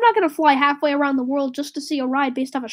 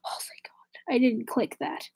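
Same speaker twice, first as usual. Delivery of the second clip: whispered relief, replayed for chat; retold relieved whisper; 2 semitones lower, 10 dB quieter than usual. Streamer retelling near-miss with the X button, whispering. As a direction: whisper it like you just dodged disaster. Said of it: "and I was like".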